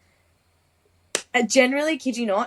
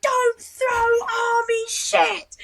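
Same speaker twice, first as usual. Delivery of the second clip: very high, long-drawn mock shout; retold outraged yell; much slower than usual. Instructor reacts to thrown caches, outraged high shout. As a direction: stretch each word in a high yell.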